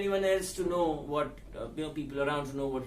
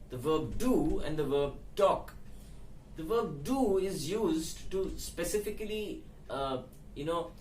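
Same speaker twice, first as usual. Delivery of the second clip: ordinary delivery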